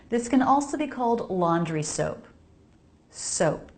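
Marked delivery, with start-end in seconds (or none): none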